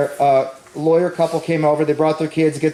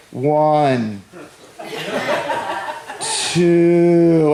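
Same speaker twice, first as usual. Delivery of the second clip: slow dramatic count. Retold or imitated. Counting endless seconds slowly.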